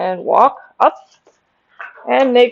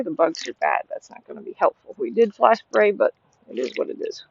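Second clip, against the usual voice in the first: muttered aside; 8 dB quieter than usual. Real, not acted. Aside about the bugs.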